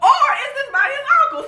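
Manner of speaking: high, fast, laughing musing; puzzled wondering. Retold or imitated. Voicing Tanya's confusion at the door, high laughing puzzlement. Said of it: "at first it's like".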